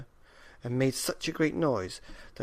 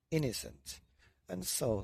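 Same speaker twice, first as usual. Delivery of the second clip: ordinary delivery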